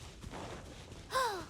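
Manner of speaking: sharp breathless gasp; startled gasp. Acted. Spotting monsters by the bonfire, a quiet startled gasp. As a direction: suck in air in surprise.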